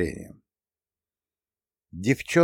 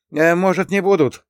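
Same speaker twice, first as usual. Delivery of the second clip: high, faltering hopeful question; squeezed-out hope; 8 semitones higher, 4 dB louder than usual. A narrator's reading. Sinitsyn asks if rangers will attack, high and hopeful. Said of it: "с надеждой выдавил Синицын".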